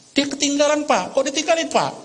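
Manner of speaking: high-pitched mock-tearful complaint; lightly acted child's wail; acted crying child. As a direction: play an upset daughter complaining to dad. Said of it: "Anaknya nangis".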